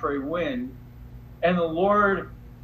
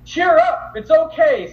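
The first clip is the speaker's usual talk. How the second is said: much higher and louder, breezy cheer; mock chirpy reassurance; 11 semitones higher, 9 dB louder than usual. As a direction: chirp it like you're brushing off her panic. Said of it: "kind of telling her".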